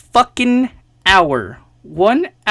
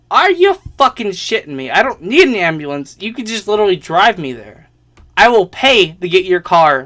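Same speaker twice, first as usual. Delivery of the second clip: retold exasperated outburst, punched louder; indignant protest; 5 dB louder than usual. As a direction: argue back in disbelief, replaying the row. Retold argument over getting a ride.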